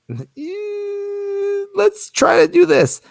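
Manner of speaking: high gentle coaxing; gentle boss. Shown as a demonstration. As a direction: ease them into it kindly. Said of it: "temper it with".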